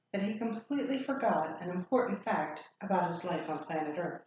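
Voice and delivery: usual speaking voice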